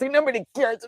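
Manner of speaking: mock sulky whine. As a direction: whine "Nobody cares." like a hurt kid. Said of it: "Versus I'd be more like".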